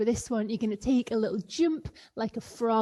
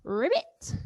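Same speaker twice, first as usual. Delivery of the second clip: a higher, bouncy frog ribbit; 5 semitones higher than usual. Pretend. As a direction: croak it like a little leapfrog. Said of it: "be a little leapfrog".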